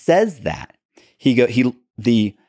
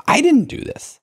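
indignant protest. Retold or imitated. Protesting innocence to detective.

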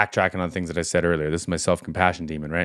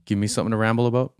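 ordinary talk

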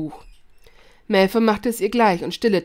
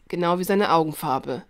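usual speaking voice

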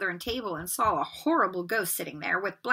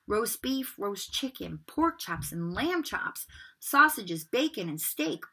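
ordinary delivery, nothing marked

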